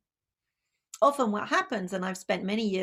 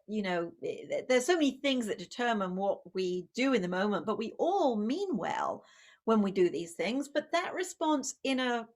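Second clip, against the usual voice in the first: ordinary talk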